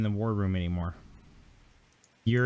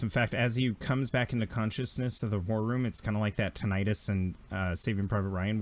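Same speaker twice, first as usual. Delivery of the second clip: ordinary talk, nothing marked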